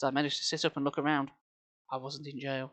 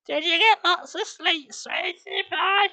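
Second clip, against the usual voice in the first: put-on very high, slow baby talk; cooing mum; 5 dB louder, slower than usual. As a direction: coo sweetly at the baby.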